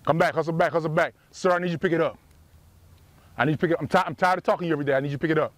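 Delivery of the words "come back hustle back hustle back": ordinary talk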